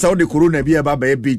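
ordinary delivery

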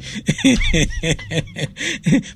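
sudden high-pitched laugh; high burst of laughter. Real, not acted. Host cracking up at his own joke, high laugh.

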